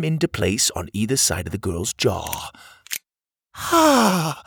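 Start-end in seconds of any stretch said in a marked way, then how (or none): 3.5-4.4 sharp high gasp as the jaw frees; high gasp of relief. A narrator's reading. Freed cog girl reacting, high loud gasp. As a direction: gasp as if breathing again. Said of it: "the little cogger gasped".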